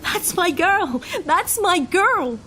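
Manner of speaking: high, drawn-out anguished cry; screaming. A narrator's reading. Man sees his girl on the gurney, screaming. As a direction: scream it slowly, stretching each word. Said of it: "I screamed".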